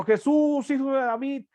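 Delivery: imitating a blind beggar's cries; crying out. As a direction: call out for mercy from afar, pitch way up. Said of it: "dio voces diciendo".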